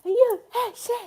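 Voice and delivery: high, bouncy mock crowd chant; loud, light, springy cheer; cheering Americans. Imitating how Americans cheer, high bouncy mock chant. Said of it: "Americans are like".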